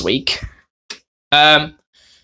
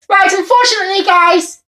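ordinary talk

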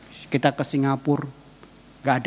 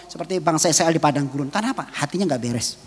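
normal talking voice

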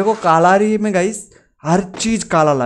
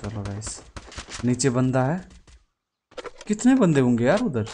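ordinary delivery

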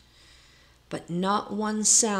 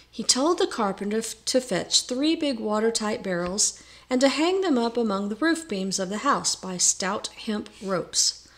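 plain reading voice

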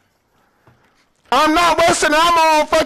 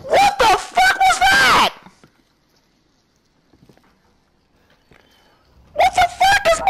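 high, panicked yell; spooked, shouting into the dark; scared shouting; 11 semitones higher than usual. Real, not acted. Something moves in the dark.

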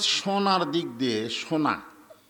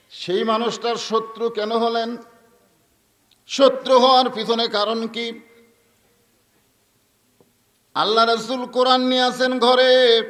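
high, loud sermon declamation; full-force preaching; 7 semitones higher, 7 dB louder than usual. Real, not acted. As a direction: ring it out to the whole crowd. (ওয়াজের সুরে উঁচু গলায় জোরালো বয়ান)

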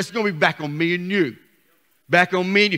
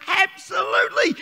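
high ringing shout; 10 semitones higher than usual. Real, not acted. Preacher answers his own question.

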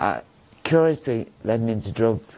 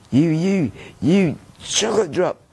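mock taunt; 6 semitones higher, 3 dB louder, slower than usual. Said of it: "when we was having an argument with this stupid girl".

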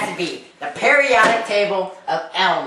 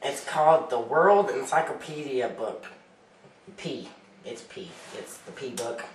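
quiet shy voice; 6 semitones lower, 10 dB quieter than usual. Acted. Guest speaks very softly.

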